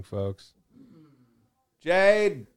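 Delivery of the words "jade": raised call toward the door outside; calling from far away. Real, not acted. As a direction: call her name across the room.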